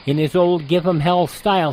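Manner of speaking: ordinary talk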